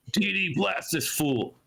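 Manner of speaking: high-pitched mock command; enemy calling to a comrade; acted battle order. Game master voices enemy ordering attack.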